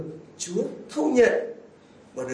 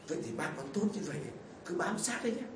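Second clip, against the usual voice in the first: soft, fast, secretive "cứ bám sát đấy nhé"; whisper-quick scheming advice; mother-in-law's quick hushed tip; 2 semitones lower, 14 dB quieter, much faster than usual. Retold or imitated. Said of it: "bà Ruth bảo".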